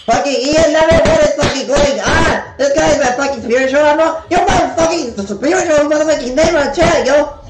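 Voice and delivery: excited, high-pitched rant; hyped-up shout-out. Real, not acted.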